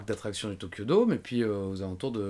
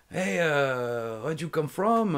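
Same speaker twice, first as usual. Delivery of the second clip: louder, higher mock Japanese-accented English; imitated Japanese accent, calling out; strong Japanese accent; 5 semitones higher, 3 dB louder, slower than usual. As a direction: hail them in thickly Japanese-accented English. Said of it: "avec un fort accent japonais".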